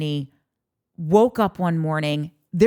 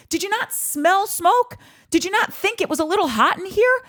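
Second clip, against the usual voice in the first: incredulous; 12 semitones higher than usual. Acted. Rhetorical jabs at checked-out partner.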